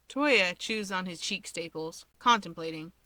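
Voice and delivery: ordinary delivery, nothing marked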